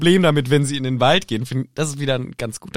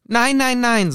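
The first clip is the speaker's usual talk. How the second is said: voicing Hermine; 9 semitones higher, 4 dB louder, much slower than usual. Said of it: "sagt Hermine".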